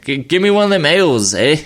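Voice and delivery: put-on goofy Canadian accent with a tagged-on eh; mock Canadian accent. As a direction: play a jokey Canadian asking for a beer, eh. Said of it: "I could talk like this".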